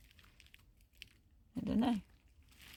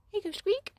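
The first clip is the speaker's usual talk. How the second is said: cooing to the cat; 12 semitones higher, 7 dB louder, faster than usual. Real, not acted. Greeting the cat Meepy, high cooing baby talk.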